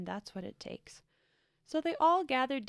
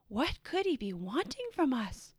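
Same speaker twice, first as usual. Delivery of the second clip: ordinary delivery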